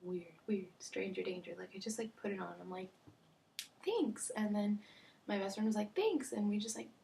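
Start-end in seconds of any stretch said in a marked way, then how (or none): 3.8-4.3 high, quick little thank-you; mock polite thanks. Girl accepts a neighbor's gift, mock polite. 5.9-6.3 high, quick little thank-you; mock polite thanks. Girl accepts a neighbor's gift, mock polite.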